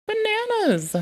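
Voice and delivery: excited squeal. Real, not acted. Streamer delighted on finding the hotkeys, squealing.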